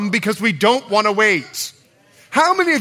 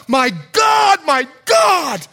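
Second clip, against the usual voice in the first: loud, high cry of anguish; 6 semitones higher, 4 dB louder, slower than usual. Acted. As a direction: cry it out from the cross, forsaken. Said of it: "Jesus on the cross cries out".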